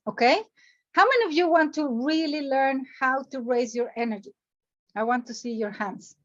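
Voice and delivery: ordinary delivery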